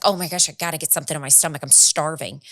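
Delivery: rushed hungry thought. Retold or imitated. Retold hunger thought.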